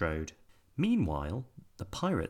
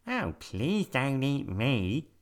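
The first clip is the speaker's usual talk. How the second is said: high, slow bouncy rabbit; 5 semitones higher, slower than usual. A narrator's reading. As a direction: speak like a little rabbit. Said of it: "said the rabbit".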